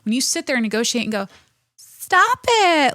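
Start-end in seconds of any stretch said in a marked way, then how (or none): 1.8-2.9 high, loud, helpless "stop it"; mock whiny parent. Mocking a parent who negotiates with a tantrum, high and loud. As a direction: squeal it like a parent losing the battle.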